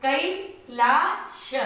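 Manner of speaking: 'कैलाश' बहुत धीरे, कै को खींचकर; 'कैलाश' का हर अक्षर धीमे साफ़; धीमा कैलाश. Shown as a demonstration. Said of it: "के ए आई". ('कैलाश' धीरे-धीरे, अंत में उठते सुर में)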